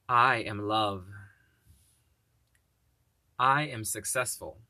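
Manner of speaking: slow, deliberate self-affirmations; measured, solemn affirmation voice; spoken affirmations. Acted. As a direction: declare each one slowly and firmly. Speaks affirmations slowly.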